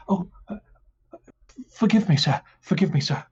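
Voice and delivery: guard grovelling to the noble; flustered apology. Acted.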